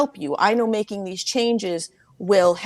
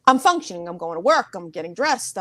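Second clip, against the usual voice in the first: ordinary delivery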